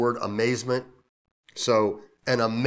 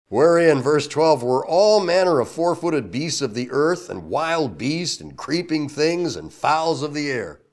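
louder and higher, slowing on the beasts; scripture reading, building; 5 semitones higher, 7 dB louder than usual. Acted. Pastor reading the vision of beasts to the church, loud. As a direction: proclaim the list of animals.

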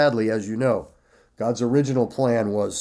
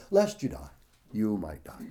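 woman's watered-down version; 4 semitones lower, 5 dB quieter than usual. Acted. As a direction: toss it off weakly, dropping away at the end. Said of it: "She went".

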